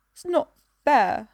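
put-on shaky, tearful child's protest; slow, wavering complaint through a tight throat; voice wobbling. A narrator's reading. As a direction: hold back tears as you say it. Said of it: "her voice wobbling".

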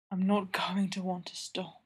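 breathy unvoiced whisper, close to the ear. A narrator's reading. Geordie to Tyler in the back seat, whispered. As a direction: whisper it so the others in the truck can't hear.

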